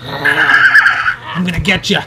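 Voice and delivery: slow drawn-out dinosaur threat; Mister Raptor. Pretend. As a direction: stretch it out like a hunting dinosaur. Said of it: "I'm Mister Rapper".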